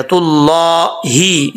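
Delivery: slow तुल्लाही with full, heavy ला and clear ही; heavy लाम. Shown as a demonstration. Teacher corrects students' ending, careful tajweed. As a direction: hold the ला of तुल्लाही, end on ही. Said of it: "अल्लाह का नाम मोटा पढ़ा जाएगा".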